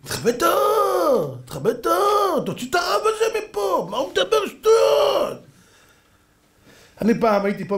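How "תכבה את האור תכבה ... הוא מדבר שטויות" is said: high, stretched mock yelling; outraged heckling. Crowd demanding the rabbi be thrown out, high yelling. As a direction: wail it out like an indignant crowd.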